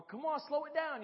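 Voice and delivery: loud high call to the preacher; call-back. Shown as a demonstration. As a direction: egg the preacher on.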